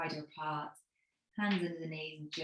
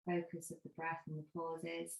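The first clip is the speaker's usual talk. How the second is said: guided meditation. Acted. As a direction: ease the class into stillness, gently. Leads the Shavasana breathing meditation.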